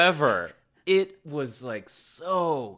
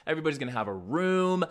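fast, higher-pitched excited pitch; excited promises; 6 semitones higher, much faster than usual. Retold or imitated. New leader selling the gang a better house, excited promises. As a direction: rattle off the perks eagerly.

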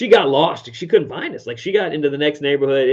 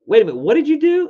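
high, loud "wait a minute" in disbelief; a mom stunned by her son's news; a mother hearing her son made two thousand dollars, high and astonished; shocked parent; 13 semitones higher, 5 dB louder than usual. Retold or imitated. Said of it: "I was like".

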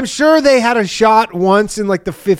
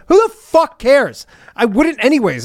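exasperated retort; 6 semitones higher than usual. Retold or imitated. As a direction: push the pitch up, exasperated and dismissive. Acting exasperated reply to secrecy plea.

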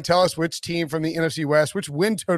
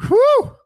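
big whew after holding breath; relieved exhale; 9 dB louder than usual. Real, not acted. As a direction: let out a loud relieved whew. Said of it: "without taking a breath".

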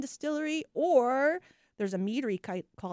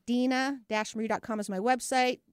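ordinary delivery

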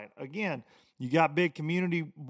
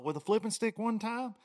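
ordinary delivery

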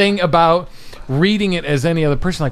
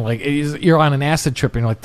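usual speaking voice

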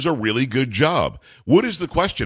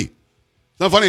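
slower, louder and higher; angry; 8 semitones higher, 4 dB louder, slower than usual. Real, not acted. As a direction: spell out each word, fed up.

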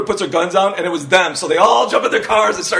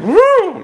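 mock woo; 5 dB louder than usual. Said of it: "like, you'd be going".

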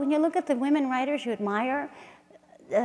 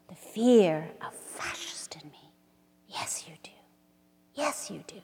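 whisper; 6 semitones lower, 8 dB quieter than usual. Acted. Whispered lines in poem.

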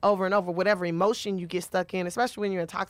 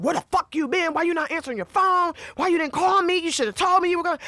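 put-on angry boyfriend; 8 semitones higher, 4 dB louder than usual. Angry boyfriend interrogating her.

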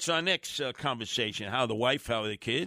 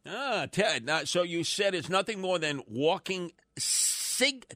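normal talking voice

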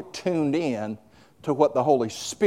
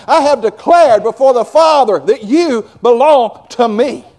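rising from loud to louder declaration; building preaching; 7 semitones higher, 8 dB louder than usual. Acted. Jesus declaring love to the believer, building loud.

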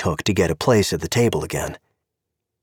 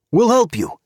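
put-on high, accented official voice; a stern Czech cop with broken English; Czech policeman; 13 semitones higher, 3 dB louder than usual. Czech cop stopping Gabe at the car, politely insistent.

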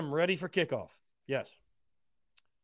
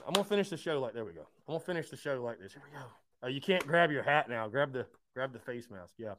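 usual speaking voice

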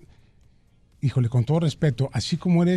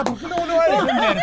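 riendo; 12 semitones higher than usual. Real, not acted. Bromea entre risas con la invitada.

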